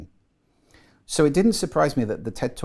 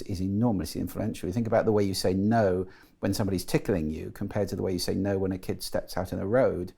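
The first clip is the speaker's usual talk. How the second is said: normal talking voice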